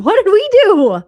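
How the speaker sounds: a loud, pretend-scared cry; overdone mock panic; mock fright. Mock alarm at host's stare.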